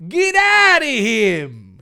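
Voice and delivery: loud and high, slowed scream. Acted. Dave screaming at the ghost to leave John, loud and high. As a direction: scream it at the monster. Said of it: "he screamed".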